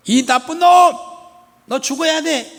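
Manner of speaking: put-on high, loud shouting mother scolding her child; mother's furious shout. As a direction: yell at the kid who broke the pot, 호랑이 물어갈 놈 hurled out high. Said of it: "엄마가". (화난 엄마가 아이에게 높이 고함치듯)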